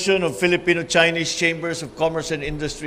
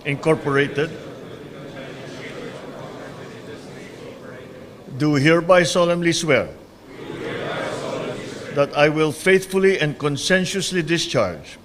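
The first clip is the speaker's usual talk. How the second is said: solemn, measured oath-taking; court oath. Acted. Administers the oath of office.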